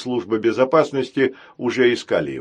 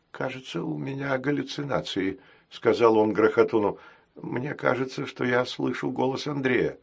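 much softer, worried care robot; hushed and uneasy; anxious murmur; 5 dB quieter than usual. Acted. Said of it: "встревоженно". (встревоженно, тихо)

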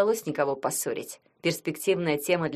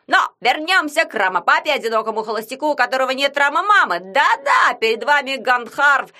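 sports commentator; 6 semitones higher, 6 dB louder than usual. Acted. Yagun commenting the match.

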